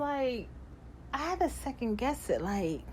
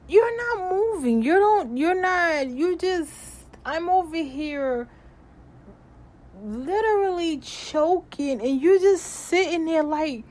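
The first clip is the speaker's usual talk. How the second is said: higher and louder, stumbling in disbelief; exasperated inner rant; 7 semitones higher, 7 dB louder than usual. Retold or imitated. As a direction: vent the frustration at him. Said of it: "thinking to myself".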